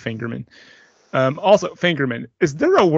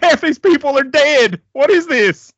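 imitating an outraged wife, high and loud; mock-furious, high, loud exclamation; 11 semitones higher, 7 dB louder than usual. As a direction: act out her indignant outburst, pitched way up. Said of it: "she was furious".

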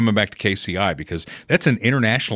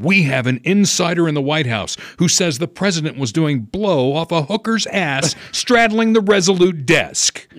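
breathless news report; 6 semitones higher than usual. Retold or imitated. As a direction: play up the juicy scoop. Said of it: "And they would have said".

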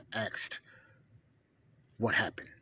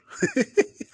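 a loud, high laugh bursting out; high burst of laughter; 10 dB louder than usual. Real, not acted. Host laughing at his own boast. Laughs after red pilled claim.

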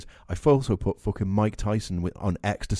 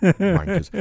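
laughing; 10 semitones higher, 6 dB louder than usual. Real, not acted. Laughing insult.

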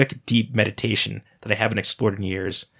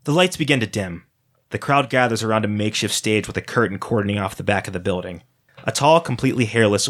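usual speaking voice

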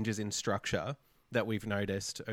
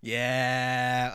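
ordinary talk

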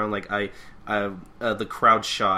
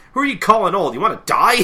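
high, loud threatening yell; comic explosive indignation; outraged old man; 11 semitones higher, 11 dB louder, faster than usual. Retold or imitated. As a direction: snap back furiously at an insult.

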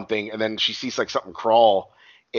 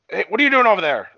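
calling out; 9 semitones higher, 6 dB louder, faster than usual. Retold or imitated. Neighbor calling out in the yard.

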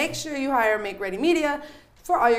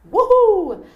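loud, high celebratory whoop; cheer; 10 semitones higher, 10 dB louder than usual. Real, not acted. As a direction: whoop it up loudly. Cheering about Patreon.

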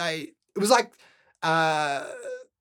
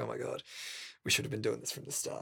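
quiet parent quote; 10 semitones lower, 16 dB quieter, faster than usual. Retold or imitated. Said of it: "my parents were like".

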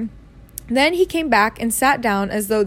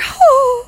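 horrified gasp; 7 dB louder than usual. A narrator's reading. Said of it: "Aunt Petunia gave a gasp of horror".

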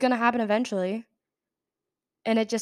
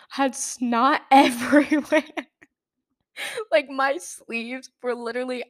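laughing mid-sentence; 2 semitones higher, 4 dB louder than usual. Real, not acted. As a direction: laugh breaks through the words, a bit louder. Laughing at gross memory.

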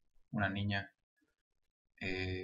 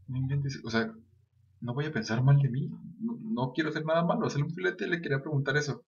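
panicky inner voice; 5 semitones higher than usual. Retold or imitated. Panicked inner thought while scaring woman.

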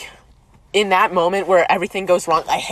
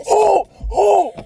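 short high-pitched "oh!" cries; high hooted oh; high theatrical "oh"; 12 semitones higher, 3 dB louder than usual. Acted. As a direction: let out a high, exclaimed oh. Dramatic cry referencing a show.

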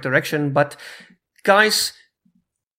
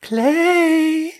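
high, drawn-out call; 13 semitones higher, 3 dB louder than usual. Real, not acted. Host calls silent co-host Clay, high calling out. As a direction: call out for a missing person, high.

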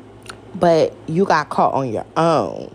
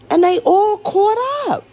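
gleeful high gloat; 13 semitones higher, 4 dB louder than usual. Real, not acted. Gloating at officials caught out.

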